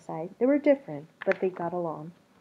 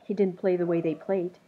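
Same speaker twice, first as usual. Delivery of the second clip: plain reading voice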